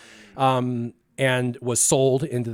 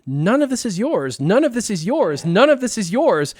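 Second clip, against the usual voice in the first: higher and louder with every repeat; None of this is yours, rising each time; 8 semitones higher, 5 dB louder than usual. Retold or imitated. Wife retelling what he said to the girl, rising emphatically. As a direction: hammer it home three times. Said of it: "you said, in Spanish".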